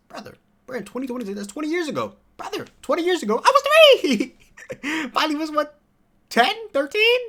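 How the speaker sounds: high, squealing shock with a laugh; disbelief. Real, not acted. Vlogger realising [name] is a decade older, high disbelieving squeal. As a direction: freak out at how long ago it was.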